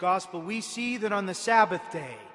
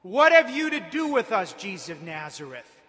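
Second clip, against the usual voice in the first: raised, forceful voice of the possessed man; crying out; 3 semitones higher, 9 dB louder than usual. A narrator's reading. Unclean spirit challenging Jesus in the synagogue, crying out. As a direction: cry it out loud, stretching the accusation. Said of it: "He cried out".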